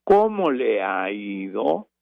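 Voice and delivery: slow, marked questioning tune on "¿Cómo le ha ido?"; question intonation. Shown as a demonstration. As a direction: ask "¿Cómo le ha ido?" slowly, stressing the question. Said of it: "Son preguntas y tiene que acentuar".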